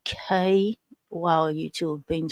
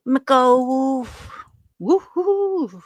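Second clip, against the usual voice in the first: excited cheer; 5 semitones higher, 4 dB louder, much slower than usual. Real, not acted. Cheering a regular's arrival.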